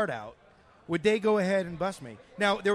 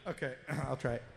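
ordinary delivery

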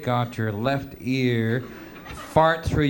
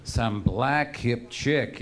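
normal talking voice

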